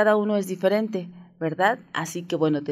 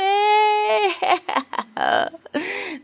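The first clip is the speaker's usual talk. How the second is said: high laugh; 3 dB louder than usual. Real, not acted. Laughs at her own whiny act.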